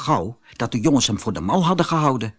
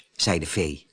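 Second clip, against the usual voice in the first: plain reading voice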